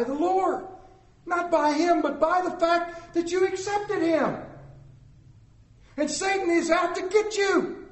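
preacher calling the church to remember their own struggle; preaching. Acted. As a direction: drive the warning home to the congregation. Preaching to the congregation.

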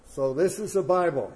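retold insistence. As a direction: press the point as the Chinese man. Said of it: "The pastor tries one more time".

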